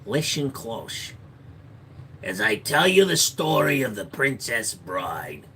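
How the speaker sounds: slow, hushed fairy-tale narration; storyteller voice. Pretend. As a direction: draw it out like a bedtime story.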